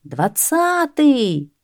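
light, sustained crowd call; crowd's cry. A narrator's reading. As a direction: stretch the number high. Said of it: "кричит народ".

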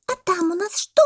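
high monkey question; curious monkey. A narrator's reading. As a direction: pipe it up high, wanting to know. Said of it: "спросила мартышка". (любопытная мартышка)

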